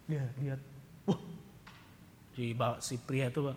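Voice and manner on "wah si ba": ordinary talk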